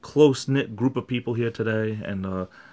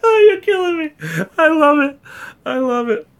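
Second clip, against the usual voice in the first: high laughing squeal; 7 dB louder than usual. Real, not acted. Reacting to joke.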